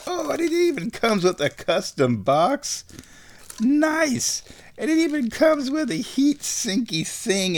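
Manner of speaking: high, gushing delight; excited high squeal. Real, not acted. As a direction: squeal over the new gadget. Gushing over unboxed parts.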